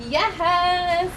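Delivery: a bright, loud, happy yes; excited cheer. Real, not acted. Host cheering to viewers after the book ends, excited. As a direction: celebrate finishing the story.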